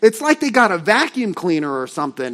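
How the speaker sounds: ordinary talk, nothing marked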